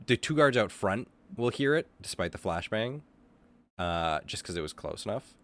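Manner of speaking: ordinary delivery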